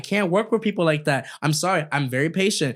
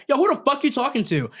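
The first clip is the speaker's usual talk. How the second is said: retold angry retort; 10 semitones higher than usual. Retold argument, his side.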